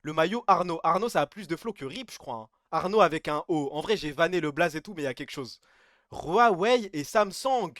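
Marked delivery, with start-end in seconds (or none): 6.1-7.7 very slow, spelled-out "Huawei et Samsung"; exaggerated pronunciation. Shown as a demonstration. Streamer reading the brand names phonetically, very slow and deliberate. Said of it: "Pourquoi t'as pris l'accent?".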